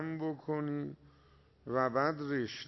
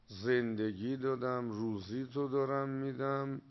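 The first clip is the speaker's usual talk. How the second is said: ordinary talk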